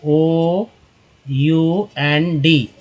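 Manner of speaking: very slow, separated letters; slow spelling. Shown as a demonstration. Spelling letters while writing. (एक-एक अक्षर बहुत धीरे)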